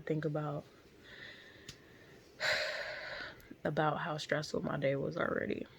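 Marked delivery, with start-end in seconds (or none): 2.4-3.6 long breathy sigh. Real, not acted. Woman recalling her stressful day, long sigh. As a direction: let out a long exhale.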